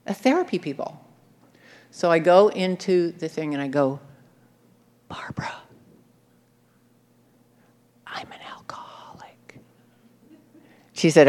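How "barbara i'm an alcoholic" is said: a timid secret, breathed out; whispered confession. Retold or imitated. To the group leader, a whispered confession. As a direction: whisper it, barely voiced.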